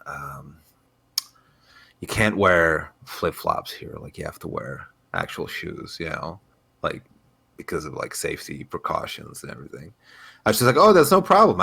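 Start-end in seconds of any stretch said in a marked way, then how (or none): 0.1-9.9 hushed, hesitant gym employee; soft and halting, an awkward official talk; gym staffer. Retold or imitated. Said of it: "and they were like". 10.8-11.6 high, breezy reassurance; easygoing "sure thing" tone; breezy agreement. Retold or imitated. Acts own breezy reply to staff.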